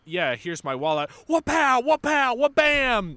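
loud cartoon punch sounds; mock fight. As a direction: shout the punches, high and loud. Acting out fighting a mugger.

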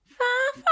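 very high vocal horn toots; high horn imitation. Host copying cohost's horn, high imitation. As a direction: copy the high horn toot. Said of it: "you go high".

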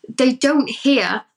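ordinary talk, nothing marked

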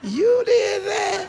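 very high, drawn-out shocked shout; incredulous outburst. Acted. Uncle to niece who gave the card, incredulous. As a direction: stretch it out in high disbelief.

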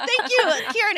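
high, giggly thank you, still laughing. Retold or imitated. Thanking the man who found her jokes funny, laughing and high.

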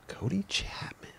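slow hushed whisper, rising. Real, not acted. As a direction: breathe the name in awe. Name said then repeated whispered.

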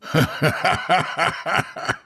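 high scornful laugh; mocking laugh. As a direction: laugh in his face. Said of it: "[name]'s laugh filled the empty lobby".

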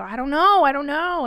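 high, loud repeated denial, acted out; flustered protest. Retelling being pressed about having kids, flustered and loud. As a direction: throw up a panicky mock defence.

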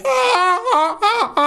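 high, laughing seagull squawks; seagull cry. Retold or imitated. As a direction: squawk like a gull on the roof. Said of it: "Flawless, nailed it".

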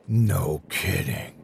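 slow dry sarcasm; drawn-out sarcastic reply. A narrator's reading. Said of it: "Crow said sarcastically".

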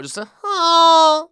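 high, loud, slow moaning ha; loud demo moan. Shown as a demonstration. Showing listeners in their car how to moan, loud high. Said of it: "Let it out".

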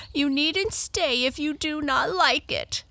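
high, whiny character voice; tearful Tearful. Acted. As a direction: protest as if about to cry. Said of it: "beginning to cry again".

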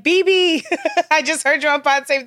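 high mock call of a nickname; imitated street call. Imagining strangers on the street, mock calling out. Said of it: "people walking up to me on the street like".

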